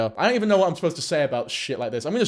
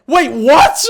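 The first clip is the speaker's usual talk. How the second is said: shocked shout; 11 semitones higher, 15 dB louder than usual. Real, not acted. Shocked that the account is gone.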